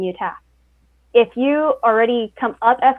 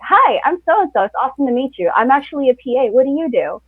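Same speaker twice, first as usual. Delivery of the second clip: confident greeting; 4 semitones higher than usual. Retold or imitated. A confident PA meeting a crew member: eager confident hello. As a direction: greet them like you've already hit it off. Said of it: "It's the people that are like".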